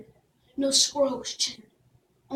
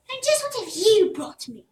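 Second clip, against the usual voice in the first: higher, haughty, demanding; scoffing; 6 semitones higher, 3 dB louder than usual. Acted. Princess scoffing at Jack, high and haughty. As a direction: look down on him. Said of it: "scoffed the princess".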